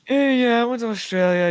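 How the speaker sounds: high, loud bragging repeat; high, loud boast; mock-proud exclaim. Real, not acted. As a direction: trumpet it proudly. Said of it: "bring up he went to Australia again".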